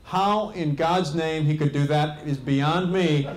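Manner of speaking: ordinary talk, nothing marked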